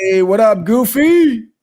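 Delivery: big excited hey; loud greeting. Real, not acted. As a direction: shout the hello across the room. Greeting a friend joining the game.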